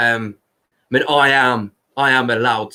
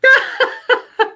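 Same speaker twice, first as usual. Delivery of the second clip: high laugh; 4 dB louder than usual. Real, not acted. Laughing at own camera joke.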